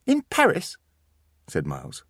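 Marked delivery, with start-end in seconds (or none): 0.1-0.8 put-on high smug small-boy question; a clever boy showing off; smug child. Miles checks the city smugly, high child voice.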